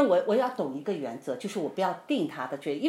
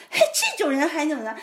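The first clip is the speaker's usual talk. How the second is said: high, loud indignant huff; acted exasperation; 8 semitones higher, 5 dB louder than usual. Wife fuming about husband, acted exasperation. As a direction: grumble in disbelief at an impossible spouse. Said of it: "哎，我就很生气".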